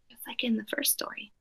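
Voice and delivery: whispered aside; teacher whispering to students mid-story. Real, not acted. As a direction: drop to a whisper for the reminder. Aside to the listening class.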